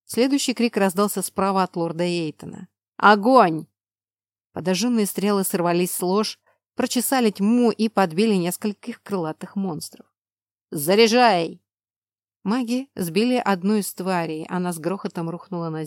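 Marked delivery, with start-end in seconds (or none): 3.0-3.7 loud shouted order; shouted command. Acted. Order to shoot arrows. 10.7-11.6 loud barked order; shouted command. Acted. Order to reload.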